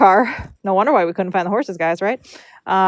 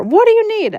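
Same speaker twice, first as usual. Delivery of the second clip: high-pitched mock yell through a door; retold yelling; 13 semitones higher than usual. Homeowner to braiders through the shut door, high mock yell. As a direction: call it out high, imitating an annoyed homeowner. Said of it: "yelling through the door".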